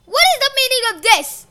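high, loud bellow. A narrator's reading. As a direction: bellow in outrage. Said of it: "Uranus bellowed".

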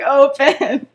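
play-yell a command with a laugh in it; retold yelling. Girl yells at a blank map in the library, mock yell. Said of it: "she's just yelling at an empty piece of paper".

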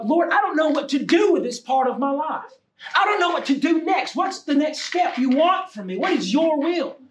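high, urgent appeal to the Lord; pleading prayer. Acted. Models a pleading prayer to God.

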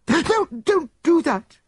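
very high, loud, drawn-out frightened plea; terrified gasp. A narrator's reading. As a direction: beg in panic, pitched right up. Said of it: "she gasped".

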